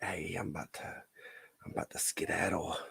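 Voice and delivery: hushed unvoiced whisper, sneaking away. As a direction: whisper it so she won't hear. Sneaking out while she cries.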